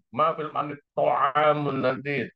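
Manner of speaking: Ma fil mat'ami to'amun nanti with to'amun pressed; stress on to'amun. Shown as a demonstration. Teacher answering the imagined friend with stress on to'amun. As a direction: put the weight on to'amun. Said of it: "Anda tekan to'am".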